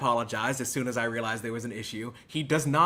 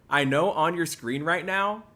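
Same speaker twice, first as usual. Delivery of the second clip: ordinary talk, nothing marked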